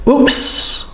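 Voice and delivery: a little slip-up oops. Shown as a demonstration. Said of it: "When we make a mistake".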